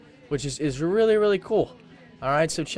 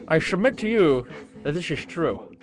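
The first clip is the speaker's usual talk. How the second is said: mock Sherlock Holmes; 3 semitones lower, slower than usual. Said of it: "Says Sherlock Holmes".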